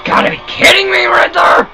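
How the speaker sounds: high, strained shout of disbelief; outraged yell. Real, not acted. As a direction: yell it at the villain in exasperation. Crashed again, blames Riddler.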